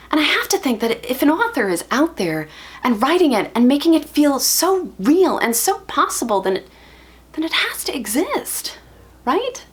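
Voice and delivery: desperate to be reassured, voice lifted; pleading hope. Acted. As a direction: build the hope, then falter into questioning. Acted plea that love exists.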